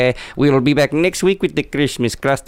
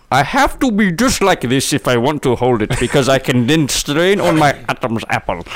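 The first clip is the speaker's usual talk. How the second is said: strained, higher Indian accent voice; over-the-top Indian accent; 3 semitones higher than usual. Shown as a demonstration. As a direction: push an exaggerated Indian accent from the throat. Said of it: "I have to do it over the top".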